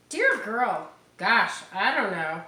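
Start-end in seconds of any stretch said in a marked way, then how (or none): none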